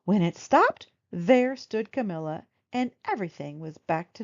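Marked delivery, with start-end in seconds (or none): none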